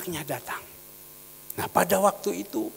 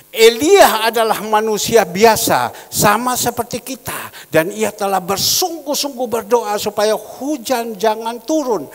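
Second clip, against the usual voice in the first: scripture proclamation; 4 semitones higher, 11 dB louder than usual. Acted. Elijah verse read out for the congregation, loud and declamatory. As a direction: proclaim the verse, then let it settle.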